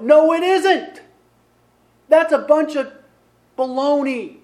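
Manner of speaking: loud, high, drawn-out denial; forceful, slow, punched-out dismissal; emphatic rebuttal. Real, not acted. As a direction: shout down the objection from the pulpit. Preacher rebuts the voiced objection.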